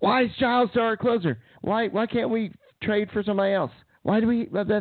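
high-pitched complaining wife impression; mock wife screaming. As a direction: squeak it out like an upset fan wife. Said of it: "my wife was screaming at me".

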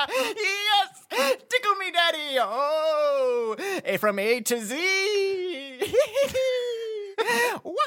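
high shrieking laughter; being tickled. Acted. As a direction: laugh wildly, gleeful. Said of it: "can we get some just [name] laughter".

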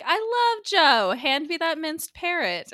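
high, slow, gushing mock mom. Retelling the mom's line, high gushing voice. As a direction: gush it like a doting mother. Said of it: "her mom is like".

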